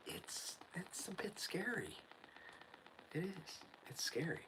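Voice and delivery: hushed, breathy whisper of unease; scared whisper. Real, not acted. Whispering to the listener that it feels scary. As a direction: whisper it like it creeps you out. Scared about the field at night.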